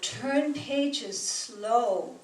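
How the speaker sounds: ordinary talk, nothing marked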